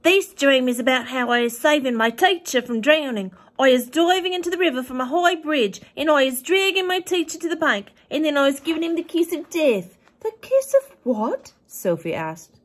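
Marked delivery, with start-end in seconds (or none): 0.0-10.0 big, high, loud giant voice, kindly and earnest; a friendly giant explaining wonders, up high and loud; the BFG. A narrator's reading. BFG reading his note. 10.2-11.5 high, slow disbelieving question; shocked girl catching a blunder; startled Sophie. A narrator's reading.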